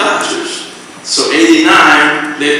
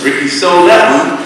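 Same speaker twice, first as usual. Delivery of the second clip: ordinary talk, nothing marked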